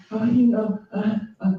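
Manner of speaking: high, whiny mock plea; mock whining. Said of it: "All that begging and whining".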